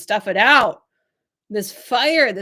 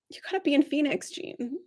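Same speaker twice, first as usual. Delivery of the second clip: ordinary talk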